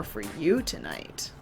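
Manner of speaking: low, slow and eerie; low haunting voice. Acted. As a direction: draw it out like a ghost story. Host's spooky story intro.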